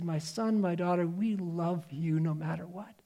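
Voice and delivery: quiet loving parent's promise; tender parent. Acted. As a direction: say it softly, like a parent hugging their child goodbye. Parents comforting struggling child.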